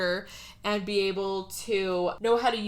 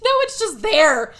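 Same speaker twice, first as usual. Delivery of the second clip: loud, high exclamation; exasperated insistence; 8 semitones higher, 10 dB louder than usual. Real, not acted. Telling a co-host about the shark's laser gun, exasperated. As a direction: burst out in comic exasperation.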